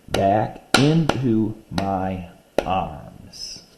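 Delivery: very slow, each syllable spaced out; slow poem reading by syllables; syllable-counting reading. Shown as a demonstration. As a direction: read it out syllable by syllable. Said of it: "Let's look at the dog one".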